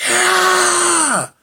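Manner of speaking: long high-pitched holler; retold holler. Brother as his sister drops, anguished holler acted out. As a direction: cry out as the brother seeing her fall. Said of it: "her brother hollered".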